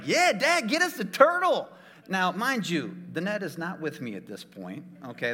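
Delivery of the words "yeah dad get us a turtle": put-on high excited kid voice; mock kids pleading for a turtle; kids begging. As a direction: beg like an eager kid. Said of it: "And the kids are like".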